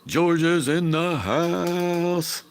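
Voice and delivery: high, loud, stretched-out mock announcer shout; hyped-up greeting. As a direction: call it out like an announcer welcoming George. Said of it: "Matt Greedy said".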